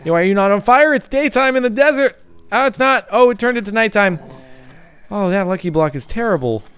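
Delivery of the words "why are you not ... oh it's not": usual speaking voice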